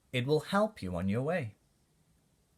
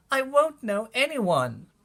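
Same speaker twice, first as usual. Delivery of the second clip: put-on higher, louder, a child's crying protest; tearful yell; 10 semitones higher, 6 dB louder than usual. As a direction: wail it out like an upset kid. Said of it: "I cried and I yelled".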